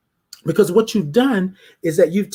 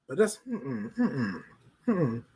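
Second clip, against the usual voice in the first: soft, dismissive mm-mms; mock disapproval; 12 dB quieter, slower than usual. Imagined critic turning someone down, softly disapproving. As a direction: trail into hushed, disapproving hums. Acted disapproval after the compliment.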